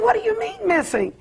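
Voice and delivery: high, quick disbelieving question; alarmed retold question, fast and high. Retelling alarmed reply to bad news.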